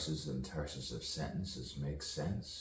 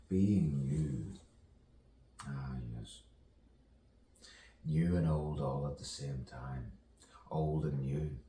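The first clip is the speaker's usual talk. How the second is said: normal talking voice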